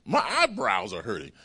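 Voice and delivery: ordinary talk, nothing marked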